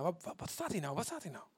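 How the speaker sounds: soft puzzled muttering; repeating to oneself. Shown as a demonstration. Reader pondering a Bible text, muttered to self. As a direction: turn it over quietly, to yourself. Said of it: "herhalen voor jezelf".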